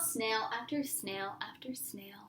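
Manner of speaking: soft unvoiced whisper. A narrator's reading. Reading to a child, whispering the snails crawling on. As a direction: hush it down to a whisper.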